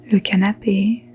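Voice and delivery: ordinary delivery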